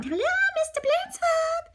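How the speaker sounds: slow high greeting; high polite phone voice. A narrator's reading. Miss Nelson phoning the principal, high and slow. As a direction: sweetly greet over the phone.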